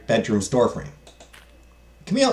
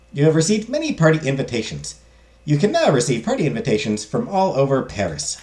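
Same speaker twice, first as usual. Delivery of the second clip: usual speaking voice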